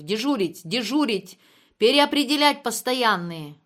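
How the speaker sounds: loud, insistent orders; shouted. A narrator's reading. Klyuev ordering Olga back on duty, shouting. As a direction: yell each command out. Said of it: "заорал Клюев".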